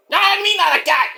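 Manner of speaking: high-pitched agitated character voice; agitated Mike. Acted. Mike protesting to the host, high agitated voice.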